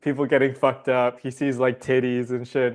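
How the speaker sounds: ordinary talk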